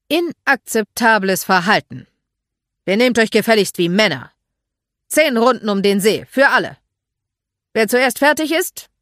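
loud, stern drill-sergeant address; commanding. Acted. Mark dressing down boys lined up before him, commanding. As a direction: lay down the punishment loudly.

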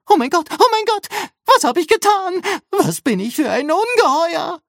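very high, loud robot panic; horrified squeal. A narrator's reading. Rambo thinks he maimed her, horrified squealing. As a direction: squeak it out frantically, aghast at yourself. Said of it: "Rambo quietschte entsetzt auf".

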